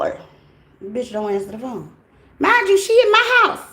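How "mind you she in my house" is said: high, loud outraged exclamation; indignant high yell. Real, not acted. Telling viewers the roommate stayed in her house, outraged yell. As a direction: shout it, furious at the betrayal.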